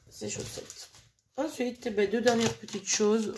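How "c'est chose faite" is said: soft whisper to herself; whispered aside. Real, not acted. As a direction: breathe it under your breath. Repeats phrase, second time whispered.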